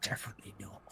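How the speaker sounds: whispered agreement. Acted. Player whispers agreement to Nathruto.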